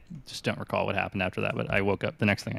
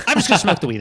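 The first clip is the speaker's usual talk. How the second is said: much higher and louder, stubborn and loud; defiant declaration; 9 semitones higher, 9 dB louder, faster than usual. Retold or imitated. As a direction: announce it like nobody can stop you. Defying girlfriend's warning.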